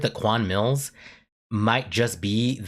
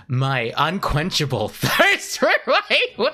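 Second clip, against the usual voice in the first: slow, higher words dissolving into laughs; breaking into laughter; 6 semitones higher, 3 dB louder, slower than usual. Real, not acted. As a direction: crack up before finishing the phrase. Cracks up at own confession.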